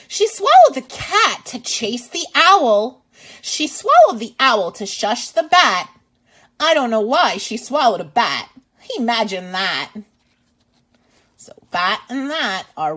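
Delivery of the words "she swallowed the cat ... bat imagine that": lively, louder read-aloud voice; reading to children. Acted. Teacher reading a picture book to kids, lively and loud. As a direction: read it out big for little listeners.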